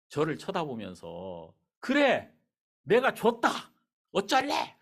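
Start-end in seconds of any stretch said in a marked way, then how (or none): 1.8-4.8 higher, louder mock shout of defiance; defiant retort. Wife shouting back at husband about the given shoes, defiant. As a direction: fire it back, daring him to object.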